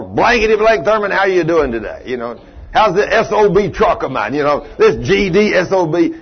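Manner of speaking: a hint of a gruff old man cussing; mildly hammed-up cranky old mechanic's customer; rough old cusser. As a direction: lightly play the foul-mouthed customer. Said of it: "that's the way he talked".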